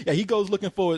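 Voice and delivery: normal talking voice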